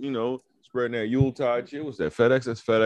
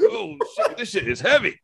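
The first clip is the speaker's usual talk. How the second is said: startled high yelp. Retold or imitated. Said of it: "You're like".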